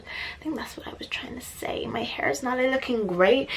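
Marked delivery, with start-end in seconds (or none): 0.0-1.9 whispered repeat. Real, not acted. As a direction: breathe it out, no voice.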